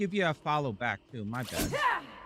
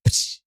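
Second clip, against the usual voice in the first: mock slap noise; 8 dB louder than usual. Imitated slap from Lucy.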